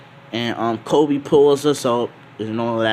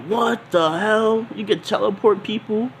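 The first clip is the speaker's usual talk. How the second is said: stunned Luffy; 10 semitones higher than usual. Acted. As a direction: stretch out the shock. Luffy shocked by teleport.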